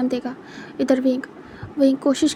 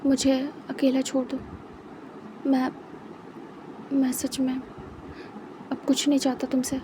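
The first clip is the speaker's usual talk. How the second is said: softer, slow, broken off; weary heartbreak; 7 dB quieter, slower than usual. Acted. Heartbroken Wang ji asking Wing to leave him alone, soft and slow. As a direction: barely get the words out, drained.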